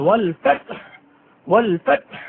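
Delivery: ফাতহ ending in a breathy throat ha; throaty ha. Shown as a demonstration. Teacher demonstrating the throat ha in ফাতহ. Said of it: "গলায় শোষ শব্দ হবে".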